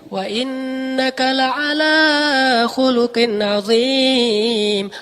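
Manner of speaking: very slow, drawn-out recitation full of wonder; awed recitation. Shown as a demonstration. Preacher shows God's awe at the Prophet's character. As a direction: stretch every word in admiration. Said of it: "Allah kayak takjub".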